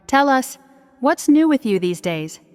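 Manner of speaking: plain reading voice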